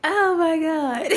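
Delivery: high, slow, helpless laughter through the words; real laughing. Real, not acted. Vlogger cracks up at own joke, laughing hard. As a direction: gasp it out while cracking up.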